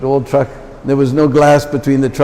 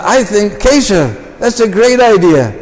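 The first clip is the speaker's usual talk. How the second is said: higher, eager yes; eager agreement; 6 semitones higher, slower than usual. Retold or imitated. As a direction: jump on the idea happily.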